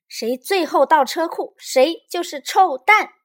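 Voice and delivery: high, loud excited challenge; playful dare. A narrator's reading. Little Bear dares Lizi to race, playful and excited.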